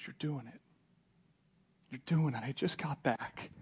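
soft, slowing, heartfelt delivery; hushed good news. Acted. Timothy reports back to discouraged Paul, hushed. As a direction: break the news quietly, letting each phrase land.

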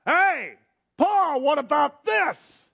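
high, loud, slow call from the corner; heckling choir. Retold or imitated. Greek choir calls out an objection to Paul, loud and high. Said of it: "anticipating what they would say".

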